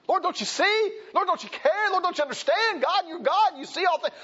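high, loud pleading prayer; desperate plea. Acted. Believer pleading with a sleeping Lord, high desperate cry. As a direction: beg the Lord to wake up.

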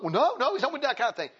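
a hint of flustered protest; defensive young man. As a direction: brush off the accusation. Retold young man denying.

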